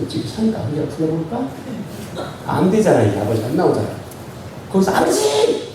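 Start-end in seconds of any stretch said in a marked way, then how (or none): 4.9-5.7 high shouted call to a stranger's father; shouting at neighbor's dad. Pretend. Preacher mimics shouting at the neighbor's father, high yell. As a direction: holler it out across the yard. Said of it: "미친놈이야".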